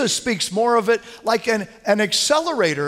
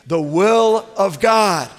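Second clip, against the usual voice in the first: slow and louder, pressing on will; emphatic unison; 5 dB louder, much slower than usual. Shown as a demonstration. Pastor leading the congregation in the phrase, slow and loud. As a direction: lead the room in saying it firmly. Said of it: "Would you say that with me?".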